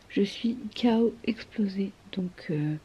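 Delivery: tired hushed whisper. Real, not acted. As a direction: murmur it, worn out. Sleepy late-night whisper.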